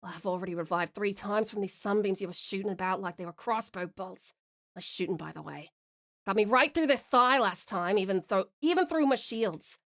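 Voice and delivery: wry, weary bad Scottish accent; amateur Scottish accent. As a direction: banter drily in a butchered Scottish accent. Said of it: "butchered it with my terrible accent".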